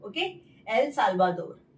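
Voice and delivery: usual speaking voice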